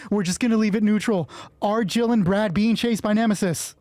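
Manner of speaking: ordinary talk, nothing marked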